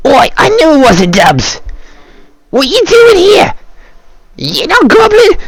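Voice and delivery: very high goblin voice, taunting; high goblin voice taunting Beowulf. Acted. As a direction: gloat at the impostor.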